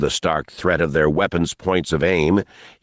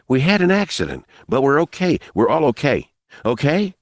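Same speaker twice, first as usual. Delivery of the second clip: put-on high, insistent boy; boy's voice; 7 semitones higher than usual. A narrator's reading. Boy reassuring armed man, boyish insistence. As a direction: protest in a raised-pitch young voice.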